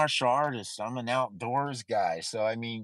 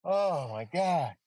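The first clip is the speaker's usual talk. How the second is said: mock tearful gratitude; 5 semitones higher than usual. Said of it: "Now I'm like".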